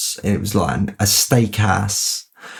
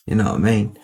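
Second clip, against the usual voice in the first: ordinary delivery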